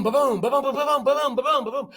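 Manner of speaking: imitated volley of gunfire; gunfire noises. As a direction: rattle off the shots like cannon fire. Imitating gunfire in history lesson.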